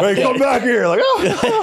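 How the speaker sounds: high, laughing shout after someone; laughing call out. Pretend. Joking to the hosts, laughing call-out. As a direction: call him back through laughter.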